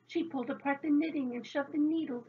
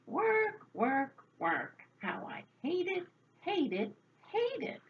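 woeful grumble, each word punched out separately; grumbling; 7 semitones higher than usual. A narrator's reading. Old woman complaining about housework, grumbling in a rhythmic lament. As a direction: grumble it like a weary refrain. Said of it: "But still she grumbled".

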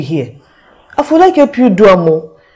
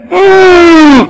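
crying moan; 6 semitones higher, 6 dB louder than usual. Acted. Cry-shout in lament.

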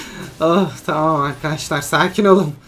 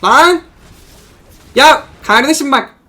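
much higher and louder, hyped-up shouting; excited yelling; 7 semitones higher, 9 dB louder than usual. Real, not acted. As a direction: bellow in disbelief at the damage. Hyped after a big damage hit.

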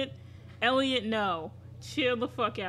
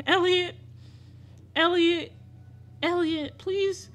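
louder, higher calls of the name; repeated high anxious cries of Elliot; alarmed calling; 7 semitones higher, 4 dB louder, slower than usual. Real, not acted. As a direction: call his name in alarm.

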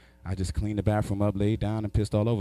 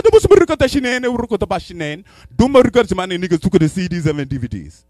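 loud mock-African gibberish with English mixed in; mock African accent; 6 semitones higher, 12 dB louder than usual. African roommate lists what was stolen, loud mock accent. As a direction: rattle it off in a put-on African accent. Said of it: "he speak half his language".

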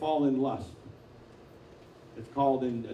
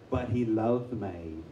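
slow Southern-accent imitation; imitated Southern woman; 4 semitones lower, much slower than usual. Preacher imitating a Southern woman on speakerphone, slow. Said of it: "this real Southern accent".

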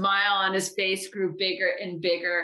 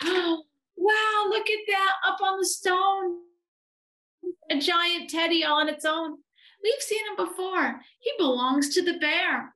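very high, wide-eyed wonder; amazed little boy; 12 semitones higher than usual. A narrator's reading. Eddie spots a giant teddy, amazed. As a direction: exclaim high with astonishment.

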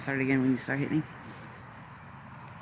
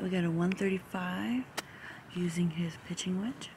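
golf whisper; 9 dB quieter than usual. Real, not acted. Whispered commentary before shot.

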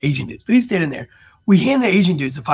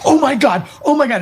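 higher, louder panicked cries; retold wife panicking, higher and louder; panicked gasping; 10 semitones higher, 4 dB louder than usual. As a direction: imitate his wife freaking out at what she sees. Wife panics at brothel doors.

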